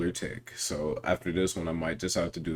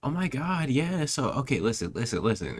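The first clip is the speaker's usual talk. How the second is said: excited gossip voice; 7 semitones higher than usual. Retold or imitated. Imitating a caller on FaceTime.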